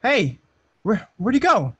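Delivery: loud, puzzled hello into emptiness; calling out. Acted. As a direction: call out for someone who has vanished. Calling out after vanished visitor.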